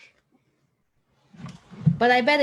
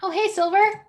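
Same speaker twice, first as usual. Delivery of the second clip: high, loud happy hello; excited greeting; 7 semitones higher, 6 dB louder than usual. Real, not acted. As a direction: greet a friend brightly. Greeting the puppet caller.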